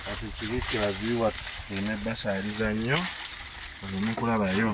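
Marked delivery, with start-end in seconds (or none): none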